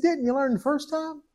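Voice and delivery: a hint of a fond heavenly father; God, amused. God voiced in his story.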